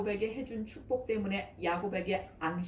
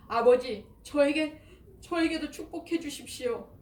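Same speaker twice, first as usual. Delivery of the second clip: much higher, louder sobbing cry; wailing plea; 6 semitones higher, 5 dB louder than usual. A narrator's reading. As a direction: cry out to his father in despair. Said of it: "목 놓아 울었다". (목 놓아 우는 애원)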